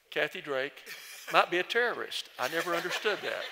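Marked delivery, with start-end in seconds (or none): 1.3-3.4 higher and louder, amused; amused disbelief. Real, not acted. Amused to the congregation about Kathy at the airport. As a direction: play up the absurdity with a laugh.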